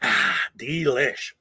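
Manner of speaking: slow, low sigh after a sip of coffee; sipping coffee. Real, not acted. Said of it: "Let me take a sip of my coffee".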